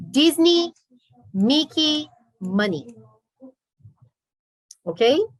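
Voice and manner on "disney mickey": Disney and Mickey said high and loud with the ee endings; emphatic model. Shown as a demonstration. Teacher drives home the ee sound for the class. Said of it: "É".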